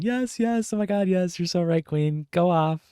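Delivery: high, squealing fangirl cheer; hammed-up giddy applause; mock gushing agreement. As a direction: gush like a fan clapping along. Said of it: "just started seal clapping".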